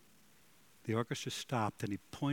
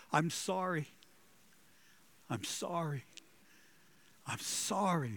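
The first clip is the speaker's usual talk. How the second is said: tearful apology; 6 semitones higher than usual. Retold or imitated. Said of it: "you could hear him crying".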